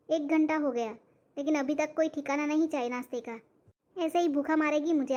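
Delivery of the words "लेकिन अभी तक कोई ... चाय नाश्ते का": usual speaking voice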